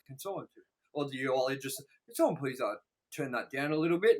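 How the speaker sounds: soft, understated aside from a priest; hushed priest. Acted. Priest asks for the screen turned down, hushed. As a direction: quietly ask over the noise, imitating a priest. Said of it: "the priest's like".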